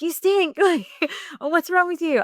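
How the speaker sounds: a half-laughing mock jab; retelling how she snapped at her husband; snappy.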